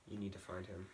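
very soft, low and weighty; low, mysterious murmur; hushed urging. Acted. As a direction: confide it like a secret mission. Grandma's secret, weighty instruction.

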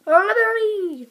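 hammed-up French accent, stretched long; bad French accent. Player showing off a footballer's name, terrible French accent. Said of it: "That was the worst French accent".

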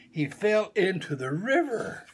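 ordinary delivery

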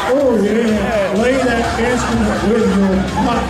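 high, energetic show host; big, high-pitched showman delivery; stage show hype. Acted. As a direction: rev up the volunteers like rock stars. Stage show host hyping volunteers.

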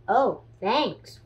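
ordinary delivery, nothing marked

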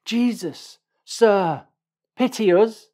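high, loud plea for pity; calling out loudly. A narrator's reading. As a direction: call out to someone far off. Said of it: "they called out loudly".

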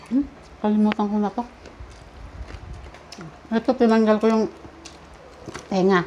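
chewing while talking; slurping noodles between words; mouth full of noodles. Real, not acted. Seen 1: Woman eats noodles throughout, forking them into mouth and chewing.

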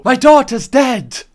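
loud, slow mock anguish; loud hammed-up wail of grief; mock dramatic wail. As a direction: ham up a tragic cry. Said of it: "You can't go".

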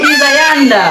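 Chittagong Bengali accent; Chittagonian dialect. Shown as a demonstration. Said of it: "এটা তো আমরা কই".

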